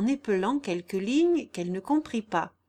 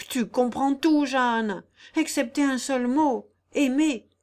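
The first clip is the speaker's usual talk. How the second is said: higher, louder, emphatic complaint; impassioned reproach; 5 semitones higher, 6 dB louder than usual. Acted. Guillaume telling Jeanne she cannot understand love, reproachful. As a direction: land the last word as an accusation.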